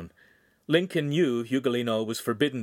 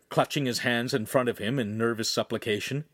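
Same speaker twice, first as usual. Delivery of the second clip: plain reading voice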